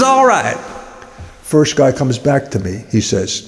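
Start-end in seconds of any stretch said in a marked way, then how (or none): 0.0-0.6 squeaky guy. Acted. Second guy answers high.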